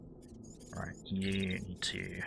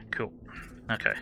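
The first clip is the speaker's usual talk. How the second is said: ordinary talk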